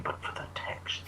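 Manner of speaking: soft, creepy whispered leer. Pretend. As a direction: whisper it like a pervert. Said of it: "That sounded really creepy and pervy".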